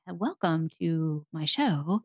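slow, low, stiff robotic welcome; nervous new podcaster reading like a robot; stiff robotic host. Retold or imitated. Host mocking her stiff first episode, slow robotic delivery. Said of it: "I sound like a robot".

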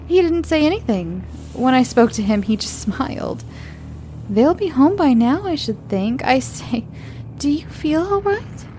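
high, cheerful chatty teacher voice; breezy and sweet, all smiles; bright young teacher. Acted. Young teacher chatting brightly to the late mother.

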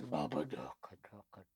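hushed, low creature voice; deep monster chant, kept very soft; Babadook. Monster murmuring all he ever says, deep hushed creature voice. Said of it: "that's literally all he says".

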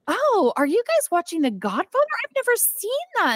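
high, airy fake-innocent voice; playing dumb. Barbie playing dumb for the Kens, high fake-innocent coo. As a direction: coo it like a clueless girl pretending. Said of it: "appear that you don't know anything".

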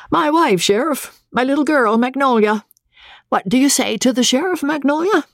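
high-pitched little captain presenting his family; high character voice, making introductions; Andy, genial boat captain. Acted. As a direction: play an eager captain in a high voice.

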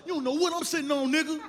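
high-pitched mock bragging; hammed-up high swagger; cocky boast. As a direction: brag defensively with the voice pushed up. Acts out a boast of hidden money.

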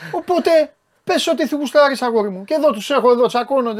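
high, loud, emphatic rant; loud holding forth. Real, not acted. Host telling his guest to say what he likes, loud holding forth. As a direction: raise your voice and hold the floor.

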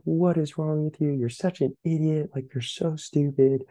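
retold self-scolding scream. Player berating himself over a missed warm-up ball, restrained retold scream. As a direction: imitate screaming at yourself, pitch up, volume held back. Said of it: "I'm just screaming".

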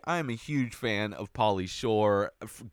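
ordinary talk

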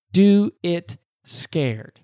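very slow, each word set apart; drawn-out motivational punchline; slow, emphatic mantra. Real, not acted. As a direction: land each word slowly and deliberately. Slow closing delivery of the mantra.

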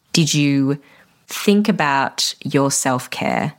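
ordinary talk

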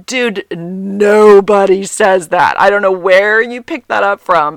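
loud, drawn-out "nobody"; exasperated scolding. Retold or imitated. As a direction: stretch and punch it in mock outrage. Retold scolding of husband.

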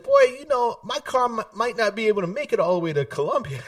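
high, amused grandfather voice; lightly voiced old man, laughing through it. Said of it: "my grandfather said".

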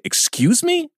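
высокое возмущённое «Excuse me?»; вскинуть голос от обиды; offended excuse me. Retold or imitated. Offended reaction to insult.

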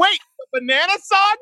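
high, loud squeal of disbelief; shrieking wait, the banana song; shocked disbelief. Retold or imitated. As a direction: gasp it out in total disbelief. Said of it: "I'm like".

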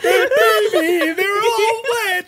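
mock weeping. Player lamenting wet family, hammed-up sobbing. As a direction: overplay the grief. Melodramatic crying bit.

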